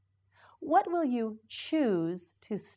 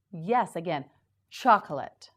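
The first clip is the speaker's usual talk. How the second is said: ordinary delivery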